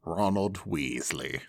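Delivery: unvoiced low whisper; hushed and slow, a nervous whisper. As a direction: sheepishly whisper the name. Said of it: "playing Ron to Hermione".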